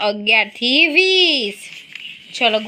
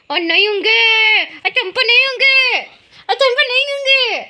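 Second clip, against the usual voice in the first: sing-song teasing, rising much higher; mock accusation climbing in pitch; playful teasing; 9 semitones higher than usual. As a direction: tease the child, building higher and higher. Teasing child about sleeping.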